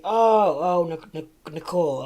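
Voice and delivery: high, loud fake surprise; mock realization. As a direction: overplay the sudden "oh". Teacher feigns sudden realization.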